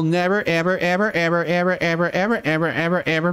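each "ever" hit with a rising push; emphatic repetition. Real, not acted. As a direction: hammer every "ever" like a drumbeat.

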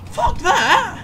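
very high, loud rising curse; real high-pitched outburst; outraged scream. Real, not acted. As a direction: shriek it in gamer rage. Rage at game.

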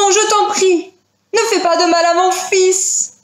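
high-pitched, urgent begging; high pleading creature voice; pleading parent fish. Acted. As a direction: beg for your child's life.

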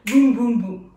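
BOUM BOUM BOUM in a quick, loud run; on the music's beat. Shown as a demonstration. Dance teacher gives the hits on the music, quick punchy BOUM. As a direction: punch out three booms back to back. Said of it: "Et là, sur la musique".

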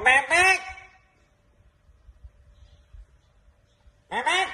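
nose held shut; pinching nose. Acted. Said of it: "Tutup hidung".

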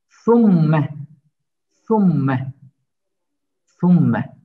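Summe with a full round u and a doubled m; clear u sound. Shown as a demonstration. Teacher drilling Summe for students, loud round u. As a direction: Summe as sum-me, lips rounded for a pure u. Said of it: "U sesi çıkarmamız gerekiyor".

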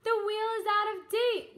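high, loud whoop. Acted. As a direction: whoop it with glee. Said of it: "whooped Hop".

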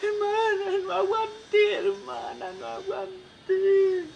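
hammed-up high, drawn-out wail; slow, comic sobbing lament; mock crying. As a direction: bawl to your sister in exaggerated falsetto. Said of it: "pa' su casa llorando".

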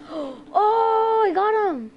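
loud, high, drawn-out cry of triumph; excited triumph. Real, not acted. Player finally catching the fish, excited triumph.

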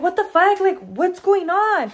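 high, loud alarmed cry of what the fuck; startled, panicky retold outburst. Retelling panic at the loud bang.

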